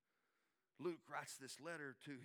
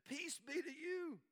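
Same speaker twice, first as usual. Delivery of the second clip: Jesus greeting; 12 semitones higher, 4 dB louder, slower than usual. Retold or imitated. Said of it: "stood among them and said".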